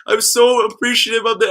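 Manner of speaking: high, tearful gushing thanks; imitated crying. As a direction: gush gratitude in a high, mock-crying voice. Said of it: "just crying and crying".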